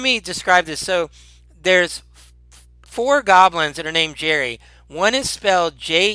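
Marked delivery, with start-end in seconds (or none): none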